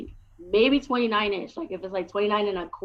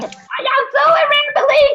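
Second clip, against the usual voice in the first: crowd hype call; 9 dB louder than usual. Real, not acted. Comic hyping the festival crowd from the stage, high and loud. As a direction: fire up the room with a big hello.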